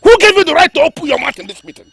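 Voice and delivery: high, loud scolding; enraged king silencing the adviser; furious tirade. Acted. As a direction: tear into the interrupter.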